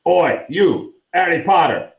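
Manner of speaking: brusque character-voiced call; rough shout. A narrator's reading. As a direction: holler to stop someone in a corridor. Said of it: "shouted the particularly grim-looking dwarf".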